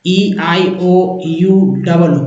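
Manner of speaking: ई आई ओ यू डबल ओ, each letter named slowly and separately; slow vowel letter recital. Shown as a demonstration. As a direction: spell out ई आई ओ यू डबल ओ letter by letter, drawn out. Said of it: "देखिए ए को". (धीरे-धीरे अक्षर गिनाना)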